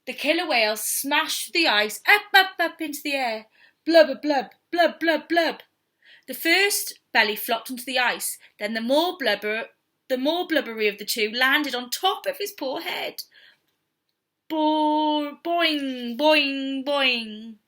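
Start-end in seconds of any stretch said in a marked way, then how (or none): 3.8-5.6 bubbling blub. Acted. Bubbling sound effect. 14.5-17.5 boing effect. Acted. Bouncing sound effect.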